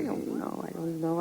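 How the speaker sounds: whispered, almost no voice; a frightened woman whispering that she doesn't know. Acted. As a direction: breathe it out, scared and cornered. Cornered suspect breaks down.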